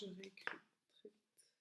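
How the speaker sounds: very soft whisper to oneself; aparté chuchoté. Real, not acted. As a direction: whisper it as an aside while busy writing. Aside while rewriting on board. (chuchoté tout bas, en aparté)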